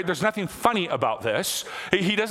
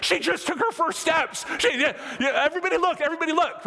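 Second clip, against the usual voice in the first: much higher, thrilled cry to the room; excited announcement; 11 semitones higher than usual. Retold or imitated. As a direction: call everyone over to see the baby, delighted. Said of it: "I was like".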